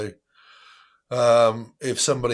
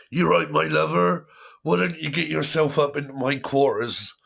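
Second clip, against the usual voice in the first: hearty pirate, a bit higher and louder; 5 semitones higher, 4 dB louder than usual. Pretend. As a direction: ham it up as a lusty pirate. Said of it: "I've started to talk like a pirate".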